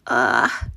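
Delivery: low, loud grunt of disgust; disgusted ugh. A narrator's reading. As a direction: groan at the rubbish. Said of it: "she cried".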